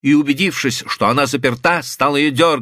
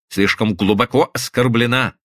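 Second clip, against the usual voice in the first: usual speaking voice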